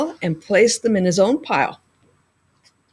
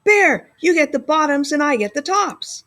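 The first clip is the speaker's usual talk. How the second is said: calling out; 8 semitones higher than usual. A narrator's reading. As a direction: shout across to the sleeping bear. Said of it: "Hare called out".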